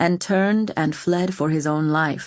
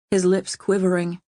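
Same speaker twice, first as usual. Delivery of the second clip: plain reading voice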